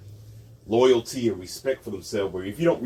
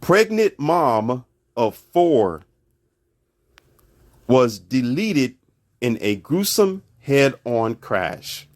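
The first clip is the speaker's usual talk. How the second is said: slow reading; 4 dB louder, much slower than usual. Real, not acted. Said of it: "Now listen carefully".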